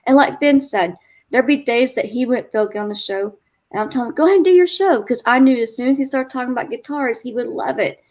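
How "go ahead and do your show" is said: higher and louder, pushing him out the door; loud cheerful nudge to go on; urging him on. Retold or imitated. Urging husband to do show.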